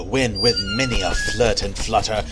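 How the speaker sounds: usual speaking voice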